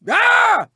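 short high cry of alarm; startled yell. Acted. Racer hit mid-race, high startled yell. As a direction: yelp out high as if just hit.

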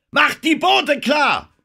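very high, loud, drawn-out shout; screamed order. A narrator's reading. Denham orders the crew to ready boats, screaming. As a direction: yell the command at full force. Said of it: "schrie er".

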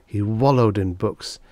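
ordinary delivery

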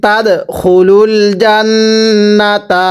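slow recitation with long nasal hums; recite-along model. Shown as a demonstration. Teacher has students repeat it, slow recitation. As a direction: stretch আং and জান্নাতা in a measured chant. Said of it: "আরেকবার পড়বেন".

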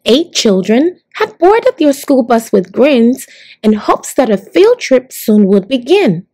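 usual speaking voice

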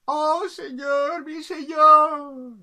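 high, loud, drawn-out acclamation; adoring subjects. Acted. People below hail the capricious king, high drawn-out acclaim. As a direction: chant it long and high as a crowd. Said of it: "y la gente".

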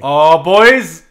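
loud yelp; sudden loud alarm. Real, not acted. Guards spot his character, loud alarmed yelp.

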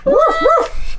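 very high playful puppy woofs; two high mock woofs; pretend dog bark. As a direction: bark like a pup that found something. Toy dog barks at find.

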